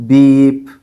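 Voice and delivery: steady, even "Beep"; high and even. Shown as a demonstration. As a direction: say "Beep" held flat like a tone. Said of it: "keep your voice high and even".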